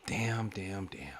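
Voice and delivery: voiceless, stunned whisper; whispered damn. Real, not acted. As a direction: breathe it out, shocked. Reacting to clip.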